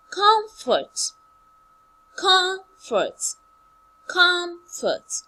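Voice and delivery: "Comfort" with very high, loud first syllable dropping low. Shown as a demonstration. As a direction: punch COM high, drop on fort. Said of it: "from high to low".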